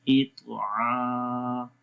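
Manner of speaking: इतआ with the आ drawn out very slowly; long ain. Shown as a demonstration. Teacher modelling the long आ slowly. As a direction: stretch the आइन sound in इतआ. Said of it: "आइन को लंबा करेंगे".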